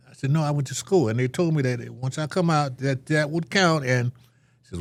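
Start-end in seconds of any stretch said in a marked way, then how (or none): 0.3-3.8 raised, high protesting voice. Retold or imitated. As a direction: protest it, voice up and loud. Angry young self retold.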